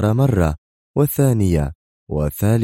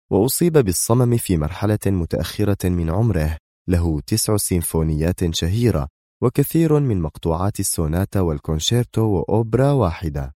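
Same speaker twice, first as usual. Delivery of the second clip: ordinary delivery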